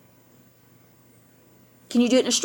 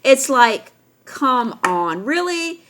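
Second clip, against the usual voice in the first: ordinary delivery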